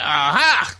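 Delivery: loud, put-on gotcha exclamation; spring it like catching someone out; theatrical eureka shout; mock triumphant aha. Speaker's own triumphant realisation.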